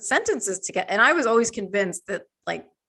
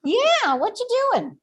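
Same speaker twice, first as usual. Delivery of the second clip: pet voice; 14 semitones higher, slower than usual. Retold or imitated. Talking to the cat in pet voice.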